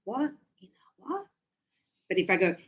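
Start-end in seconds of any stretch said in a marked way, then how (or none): 0.1-1.3 hushed, rising question; puzzled whisper. Acted. Student failing to catch linked words, puzzled whisper. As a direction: whisper it as a baffled listener.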